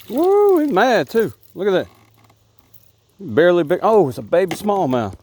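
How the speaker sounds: loud, high whoop over a catch; whoop, then keep exclaiming high and loud; real excitement. Real, not acted. Hard-fighting fish on the line.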